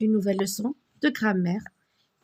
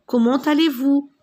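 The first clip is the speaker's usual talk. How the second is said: "Comment allez-vous?" lifted higher and louder; raised question voice; 5 semitones higher, 5 dB louder than usual. Shown as a demonstration. Teacher showing pupils how to greet someone with a question. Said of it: "vous devez toujours élever un peu la voix".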